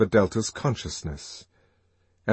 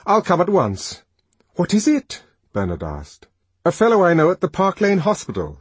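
urgent; 7 semitones higher, 6 dB louder than usual. A narrator's reading. Urgent news.

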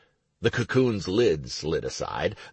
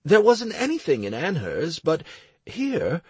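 put-on higher, lively young priest; young man voice; 12 semitones higher, 4 dB louder than usual. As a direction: voice Clowder up and eager. Clowder continues.

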